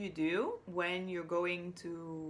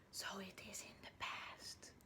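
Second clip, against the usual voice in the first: soft whispered hint; whispered past-tense hint; 11 dB quieter than usual. Shown as a demonstration. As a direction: keep it a whispered secret. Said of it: "whispering to show the word".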